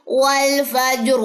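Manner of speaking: والفجر drawn out slowly with a thick ra at the stop; heavy ra. Shown as a demonstration. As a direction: recite والفجر with a heavy final ra. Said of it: "تو کیسے پڑھا جائے گا؟".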